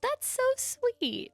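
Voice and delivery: 1 touched. Real, not acted. Touched by the gift.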